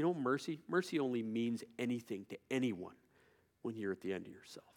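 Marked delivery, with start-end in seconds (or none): 3.6-4.7 quiet. Real, not acted. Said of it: "Did I say that loud enough?".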